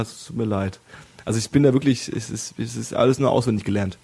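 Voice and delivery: normal talking voice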